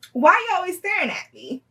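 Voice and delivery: high-pitched mock confrontation; bold confrontation. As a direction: blurt it out with tipsy courage. Tipsy confrontation of the woman, retold.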